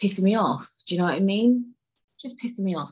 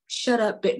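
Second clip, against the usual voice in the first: higher, sudden jokey command; mock dad retort; 5 semitones higher than usual. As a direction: snap it as an exaggerated dad. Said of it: "No, he wasn't, he wasn't".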